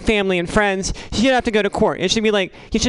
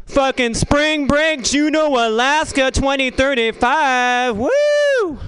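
higher, slow, hyped spring-break shout ending in a whoop; party bro whoop; 6 semitones higher, much slower than usual. Retold or imitated. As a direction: ham up a partying bro yelling woo.

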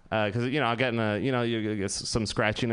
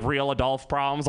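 ordinary delivery

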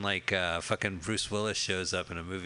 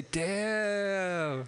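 mock damn; 9 semitones higher than usual. Voicing associates' reaction.

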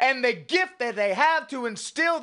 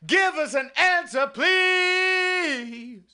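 pleading shout; 5 semitones higher, much slower than usual. Acted. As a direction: cry the plea out to heaven. Prayer peak, shouted plea.

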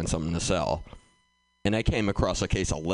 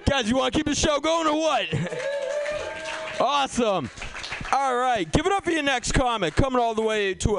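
MC intro. Acted. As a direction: sell the next comic to the room. Host introduces next comic.

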